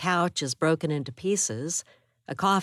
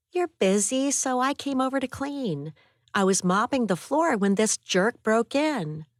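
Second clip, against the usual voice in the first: put-on young woman voice; 8 semitones higher than usual. A narrator's reading. Abby explains why she came.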